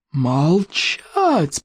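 long drawn-out mock roar; commanding berserker roar; roaring chief. A narrator's reading. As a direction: stretch the command like a bellow, volume held back. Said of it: "проревел вождь берсерков".

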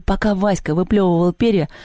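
usual speaking voice

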